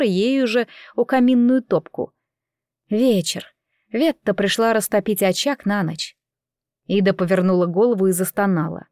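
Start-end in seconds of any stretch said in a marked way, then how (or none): none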